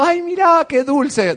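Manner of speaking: high, gushing coo; amazed onlooker. Retold or imitated. Onlooker charmed by the thieving monkey, cooing high. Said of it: "como asombrado".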